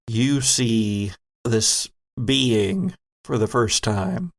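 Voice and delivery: usual speaking voice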